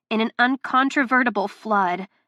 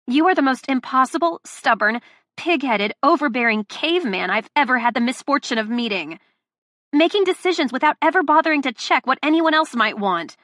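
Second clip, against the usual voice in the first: loud, raised, furious listing; angry rant; 4 semitones higher, 3 dB louder than usual. Acted. Delilah berating Luca, angry and loud. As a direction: tear into him. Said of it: "this rant".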